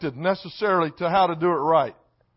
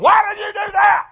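high, loud mock yell at a kid; coach hollering; 8 dB louder than usual. Coach yelling at a kid who threw the ball away, loud mock yell. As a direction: bark it like an angry Little League coach. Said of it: "the coach is yelling".

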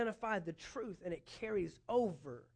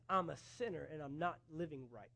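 a soft, much quieter admission, dropping away on "I need a Savior"; a lowered, humbled sinner's voice; hushed confession; 6 semitones lower, 10 dB quieter than usual. Retold or imitated. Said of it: "then you realize".

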